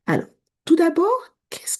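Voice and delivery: whispered, unvoiced throughout; conspiratorial whisper. Vlogger whispers to her viewers, a conspiratorial whisper. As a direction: lean in and whisper it like a secret.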